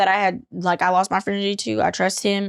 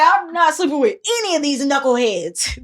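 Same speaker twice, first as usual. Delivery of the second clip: louder put-on sass; sassy declaration; 5 semitones higher, 3 dB louder than usual. Swearing off the knuckleheads, loud and sassy. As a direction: declare it like a diva. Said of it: "I was saying".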